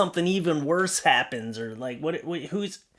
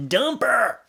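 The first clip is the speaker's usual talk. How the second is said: DUMP her, higher and punched; emphatic dump her; 9 semitones higher than usual. Real, not acted. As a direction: hit Dump hard and high. Emphatic advice.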